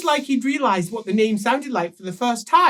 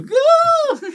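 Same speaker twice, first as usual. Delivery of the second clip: high squeaky startled yelp; a sudden shocked squeal, way up high; 7 dB louder than usual. Retold or imitated. Player recalls his shocked reaction, high squeal. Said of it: "made me go".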